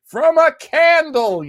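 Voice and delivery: playful high mock voice for a friend's chat comment; squeaky mock voice. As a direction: squeak it out to tease Joe. Reading Joe's chat comment in voice.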